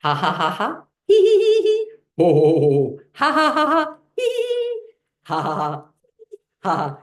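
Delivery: building from chanted syllables to full laughing; mixed laugh sounds. Shown as a demonstration. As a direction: speed up until the laugh takes over. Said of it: "slowly at first and we'll get a little bit of faster".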